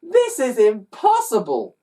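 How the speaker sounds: put-on boy wailing that it's impossible. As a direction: wail it in despair. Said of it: "the boy wailed".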